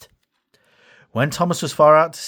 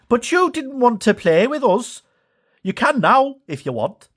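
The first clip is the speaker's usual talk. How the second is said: Bash voice; 10 semitones higher than usual. Acted. Bash speaks to Thomas.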